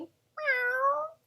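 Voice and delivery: high, tiny kitten meow; a lion squeaking a cute meow. Pretend. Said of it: "this lion here is saying".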